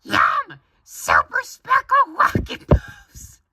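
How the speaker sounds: slow, loud falsetto squeal; Ami. Acted. Ami taking another cereal box, high excited girl voice.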